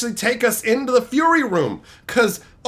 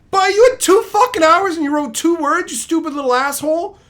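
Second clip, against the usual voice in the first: higher-pitched, exasperated telling-off; angry scolding; 6 semitones higher, 3 dB louder than usual. Acted. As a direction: scold a slacker student in exasperation. Voicing angry teacher at the beaver.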